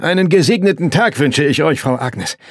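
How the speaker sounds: loud, high-pitched hailing; called out. A narrator's reading. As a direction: call the greeting across the yard. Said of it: "rief er".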